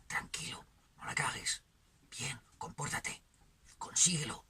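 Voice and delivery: hushed, half-whispered urging; inner-voice exhortations. Acted. Inner voices urging on waking, in a hushed half-whisper. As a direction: murmur short orders to yourself under the breath. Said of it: "gritos, exhortaciones, declaraciones vociferantes".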